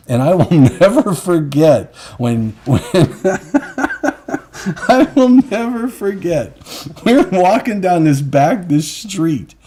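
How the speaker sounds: can barely get it out for laughing; laughing while telling. Real, not acted. Telling a friend the Marrakesh story, laughing hard.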